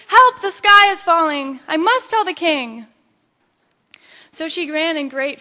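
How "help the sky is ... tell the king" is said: high, loud cry for help; frightened storybook shout. A narrator's reading. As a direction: shout the alarm, high and panicked. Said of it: "She started shouti-, shouting".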